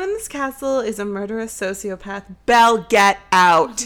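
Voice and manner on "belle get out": loud, drawn-out mock warning; urgent warning. Telling Belle to flee the murderous castle, urgent mock warning.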